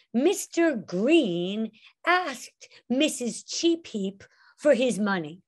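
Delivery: slow, deliberate storyteller narration; pointing at the student while narrating; narrator telling the story. Shown as a demonstration. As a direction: tell it slowly to the whole class. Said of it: "Now, I didn't say".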